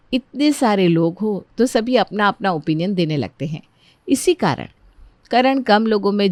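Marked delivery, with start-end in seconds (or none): none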